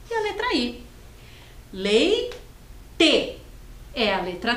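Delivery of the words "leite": "Leite" split into LEI, pause, TE; syllable by syllable. Shown as a demonstration. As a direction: say Leite as two separate clapped beats. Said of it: "A primeira sílaba é". (Leite sílaba por sílaba: LEI, pausa, TE)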